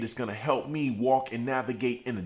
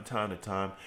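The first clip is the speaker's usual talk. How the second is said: ordinary talk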